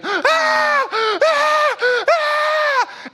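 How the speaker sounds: put-on high piercing child's screams; kid screaming. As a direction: shriek like a toddler melting down.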